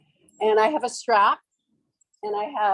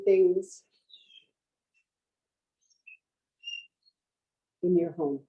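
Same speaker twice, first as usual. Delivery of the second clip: measured yoga-teacher voice with long pauses; guided meditation. Real, not acted. Teacher guiding students to picture home, meditative delivery. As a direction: guide them inward, leaving space after each phrase.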